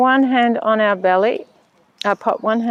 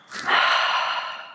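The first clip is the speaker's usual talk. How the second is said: long breathy sigh out; demonstrated relaxing sigh; audible exhale. Shown as a demonstration. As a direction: let the breath go in one long unvoiced sigh. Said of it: "and exhale".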